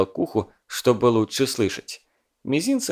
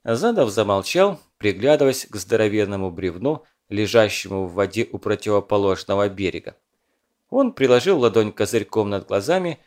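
usual speaking voice

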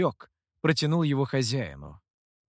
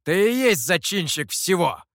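forceful, indignant outburst; heated, emphatic blame; blurted accusation; 3 semitones higher, 4 dB louder than usual. A narrator's reading. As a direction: fire the accusation straight at them. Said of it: "выпалил Михоу".

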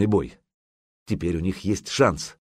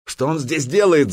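raised, louder outraged shout; yelling; 7 semitones higher, 5 dB louder than usual. A narrator's reading. Грейпюр challenges the intruder's presence, yelling. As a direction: shout the question in protest. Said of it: "заорал Грейпюр".

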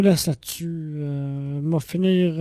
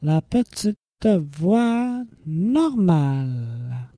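slow, drawn-out put-on little voice; exaggeratedly slow "normal" voice; much slower than usual. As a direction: stretch it out in a mock little voice. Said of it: "je vais reprendre ma petite voix normale".

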